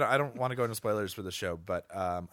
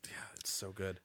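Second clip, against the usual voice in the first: soft whispered aside; 3 semitones lower, 10 dB quieter than usual. Real, not acted. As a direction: breathe it out in a whisper. Quiet aside after discussion.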